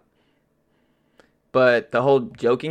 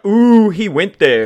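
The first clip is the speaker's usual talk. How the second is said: slow, high, loud mock gasp; a hammed-up scandalised ooh; 7 semitones higher, 8 dB louder, slower than usual. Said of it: "Not like a".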